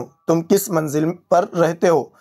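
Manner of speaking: ordinary delivery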